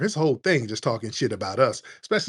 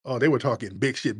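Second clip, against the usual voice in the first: ordinary talk, nothing marked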